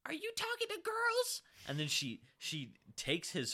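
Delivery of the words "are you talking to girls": very high mock-interrogating mom voice; high-pitched accusing parody; imitating accusing mom. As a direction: demand an answer like a scandalized mother. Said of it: "and she goes".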